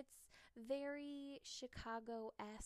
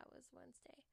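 whispered aside; 2 semitones higher, 11 dB quieter, faster than usual. Real, not acted. Aside to herself.

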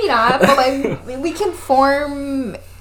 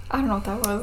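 ordinary delivery

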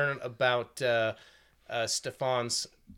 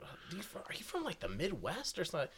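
lightly voiced puzzled questioner, softer; soft imitation of someone guessing; imitating other guys; 4 semitones higher, 14 dB quieter than usual. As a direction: quote another guy's hesitant question quietly. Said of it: "and other guys were".

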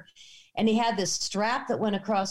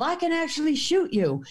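high-pitched mock yell; retold threatening yell; 8 semitones higher than usual. Farmer yelling at trespassers, mock angry threat. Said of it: "I think he even yelled".

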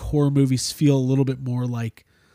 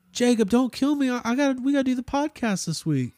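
higher, softer pretend plea; pleading fake victim; 8 semitones higher, 4 dB quieter than usual. A disguised Deadite pleads with friends to trick them, pretend pleading. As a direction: beg for mercy as a trick. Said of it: "if I became a Deadite and then, like, was trying to trick you guys".

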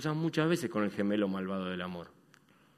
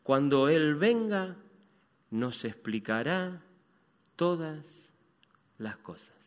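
slow reverent reading; 3 semitones higher, slower than usual. Acted. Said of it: "respondió la mujer".

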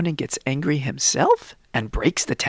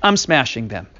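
louder, quick angry scolding; Moshe scolding; 4 semitones higher, 6 dB louder, faster than usual. Acted. Moshe scolds the people over the golden calf, loud and fast. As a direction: tell them off and smash the rules.

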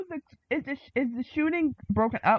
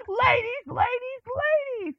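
exasperated plea; 10 semitones higher than usual. Retold or imitated. Sweetie quoted scolding at dinner.

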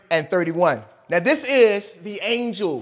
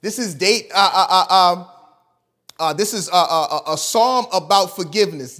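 ordinary delivery

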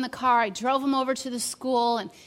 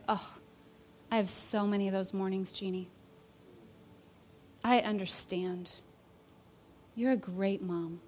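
softer, lower reassuring voice; gentle comfort; 3 semitones lower, 6 dB quieter than usual. Retold or imitated. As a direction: soothe a friend who feels like a failure. Friend comforting her gently.

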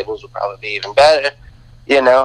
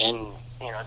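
usual speaking voice

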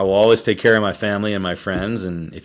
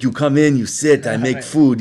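raised-pitch imitation Greek accent; heavy Greek accent; 5 semitones higher, 4 dB louder than usual. Bar owner welcoming a guest in, heavy Greek accent. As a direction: put on a thick Greek accent, higher voice. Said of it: "this heavy Greek accent".

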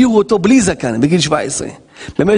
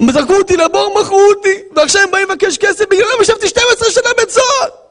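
mock crying; 13 semitones higher than usual. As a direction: fake sobbing complaint for atmosphere. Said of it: "אני עושה את זה בשביל שיהיה אווירה".

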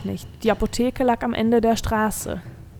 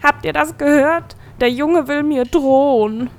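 mocking laughter; 5 semitones higher, 4 dB louder than usual. A narrator's reading. Goat demon mocks Nisto to his friends, higher and louder. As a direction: sneer at the boy for his friends. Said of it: "Capro brach in Lachen aus".

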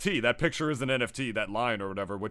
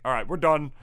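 ordinary talk, nothing marked

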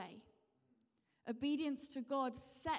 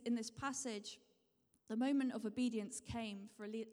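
usual speaking voice

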